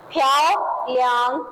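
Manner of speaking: प्याओ लियांग drawn out slowly, प्याओ stressed with a rise; slow pronunciation model. Shown as a demonstration. Teacher models प्याओ लियांग slowly for students to copy. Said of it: "उसके बाद next है आपके पास".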